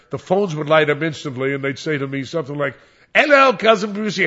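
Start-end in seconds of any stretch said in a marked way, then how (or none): 3.1-4.3 a lightly imitated English accent, higher and louder; mock English accent. Fans phoning the DJ in a put-on English accent. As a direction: callers putting on an English accent. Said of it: "Everybody had a fr-, uh, an English accent".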